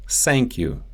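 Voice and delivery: "Thank" said as sank; Russian S for TH in "Thank you"; Russian accent. Shown as a demonstration. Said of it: "The T H sound".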